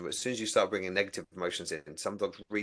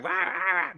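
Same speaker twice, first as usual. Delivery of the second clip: higher mock "rah rah" barks; imitating barking; 9 semitones higher, slower than usual. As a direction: bark like an overexcited dog. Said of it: "barking his head off".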